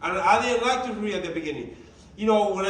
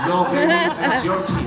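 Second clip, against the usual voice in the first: raised, louder, laughing as he tells her; excited reassurance; 5 semitones higher, 5 dB louder than usual. Retold or imitated. Husband to wife about the team, excited and laughing.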